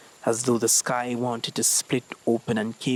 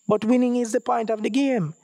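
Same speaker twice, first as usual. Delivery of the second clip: put-on higher, indignant young boy; boy protesting; 10 semitones higher than usual. A narrator's reading. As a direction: insist like a kid who doesn't get it. Ezekiel protests to Ijamar.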